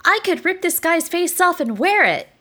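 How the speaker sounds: put-on high gleeful little girl; creepy gleeful child. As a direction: relish the gory idea. Child says something gleefully violent.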